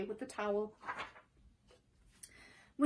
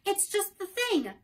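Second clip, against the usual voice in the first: put-on higher, slower delighted girl; joyful; 9 semitones higher than usual. Dorothy delighted by the plan, higher and slow. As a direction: savor the happy idea. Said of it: "said Dorothy joyfully".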